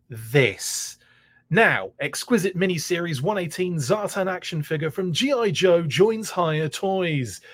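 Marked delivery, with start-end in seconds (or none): 1.5-7.4 commercial voice. Shown as a demonstration. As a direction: sell it like a TV commercial. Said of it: "That's my, uh, commercial voice".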